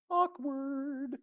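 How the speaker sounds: high, drawn-out singsong; mock awkwardness. Joking aside to the congregation about Peter, awkward singsong. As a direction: sing out the discomfort, high and soft.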